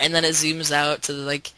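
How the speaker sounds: ordinary talk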